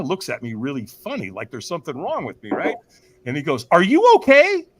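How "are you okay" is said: high, loud acted call; loud concerned call. Stranger checks on the speaker hiding at back, loud concern. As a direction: call over to someone who looks wrong.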